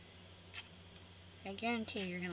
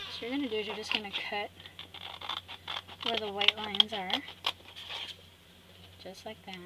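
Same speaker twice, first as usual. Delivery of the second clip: usual speaking voice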